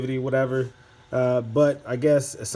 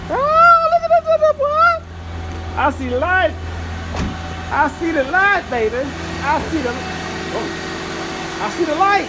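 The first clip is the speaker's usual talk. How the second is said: ecstatic shout; 8 dB louder than usual. Real, not acted. Turn signal finally works after fix.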